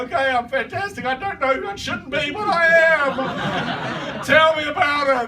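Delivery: building from high to loud, frantic shout; manic enthusiasm. Acted. Listener converts on the spot, building to excited mock shout. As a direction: work yourself up into a hammed-up yell.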